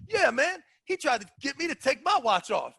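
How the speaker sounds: high-pitched retold complaint; Craig Touye. Craig walks up to both, complaining. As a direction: chime in with a high, aggrieved voice. Voices Craig joining the argument.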